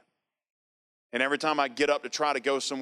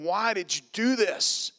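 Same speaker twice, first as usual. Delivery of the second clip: higher, slow accusing cry; bitter plea, pitched up; mad at God; 8 semitones higher, slower than usual. Retold or imitated. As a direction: drag out the question in frustration. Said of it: "just mad at God".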